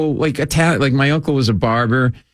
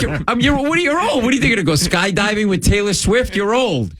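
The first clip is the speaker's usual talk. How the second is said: incredulous retort; 8 semitones higher than usual. Retold or imitated. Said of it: "I'm like".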